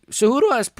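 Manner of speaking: real voice crack, pitch jumping high. Real, not acted. Streamer making a point about a fighter's striking, voice cracking. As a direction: voice breaks upward mid-sentence. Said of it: "my voice just cracked".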